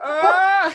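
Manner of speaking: high loud wail of pain; pained cry. Retold or imitated. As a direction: cry out like a hurt kid. Crash, bleeding, crying out.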